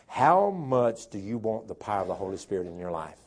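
normal talking voice

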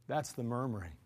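normal talking voice

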